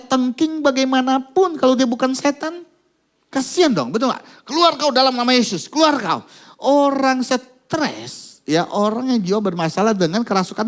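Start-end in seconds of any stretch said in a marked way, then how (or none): 4.5-6.2 higher, louder commanding cry of casting out; mock exorcist command. Pastor mocking prayer teams that cast out demons, commanding shout. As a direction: bark it like a pastor driving out a demon.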